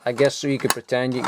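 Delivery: ordinary delivery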